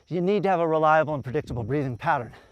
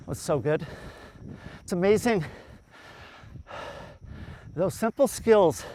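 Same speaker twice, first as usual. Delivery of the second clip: normal talking voice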